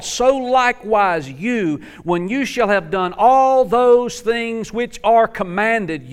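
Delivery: high, slow, weighty reading of the verse; preacher reading the text with weight; slow scripture reading. Acted. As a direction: lay each word down deliberately.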